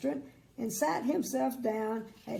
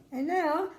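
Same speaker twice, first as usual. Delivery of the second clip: chatty woman voice; 6 semitones higher, 5 dB louder than usual. Acted.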